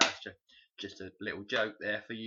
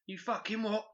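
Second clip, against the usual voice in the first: high, indignant Cockney "You fucking what?"; a jokey Cockney geezer's outrage; mock Cockney; 10 semitones higher than usual. Friend mocks Cockney gangster talk, Cockney geezer voice.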